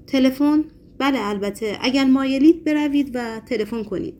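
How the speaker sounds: ordinary delivery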